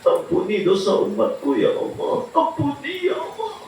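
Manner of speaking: high, pleading prayer through mock tears; a preacher voicing the Prophet's tearful plea; weeping prayer. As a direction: beg God for forgiveness, half in tears. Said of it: "sambil menangis".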